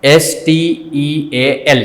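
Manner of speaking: letters spelt out slow and separate; teacher spells S T E A L for the class, slow and distinct; spelling out slowly. Shown as a demonstration. Slow spelling aloud for students, letter by letter. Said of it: "spelling જોજો".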